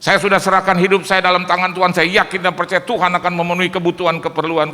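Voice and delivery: loud, slow proclaiming; full-force preaching. Acted. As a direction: proclaim the promise with power. (berkhotbah dengan lantang)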